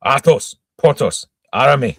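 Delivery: high, loud call, name by name; loud summons. A narrator's reading. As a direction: call out sharply for three men. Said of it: "called three times loudly".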